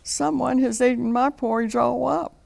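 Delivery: a small cub's upset wail, lightly done; sad baby bear. A narrator's reading. Baby bear finds his empty bowl, high and a touch sad. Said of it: "said the baby bear sadly".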